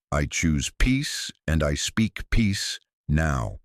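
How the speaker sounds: slow, deliberate declaration; slow and on purpose. Shown as a demonstration. Preacher modelling the declaration for a parent, slowly. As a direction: lay each word down slowly. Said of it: "Speak these swaps slowly and on purpose".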